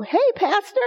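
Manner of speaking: bright high call of Hey, Pastor from a passerby; cheery greeting. Retold or imitated. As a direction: greet him like a churchgoer who spotted him. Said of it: "someone will go".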